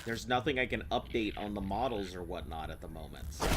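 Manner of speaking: usual speaking voice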